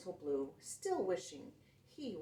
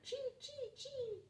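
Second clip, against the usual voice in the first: very high, soft, squeaky chee chee chee; squirrel chatter; 6 dB quieter than usual. Acted. Gray squirrel chatters at the truck from the trees. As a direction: chatter like a busy little squirrel. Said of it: "A gray squirrel chattered".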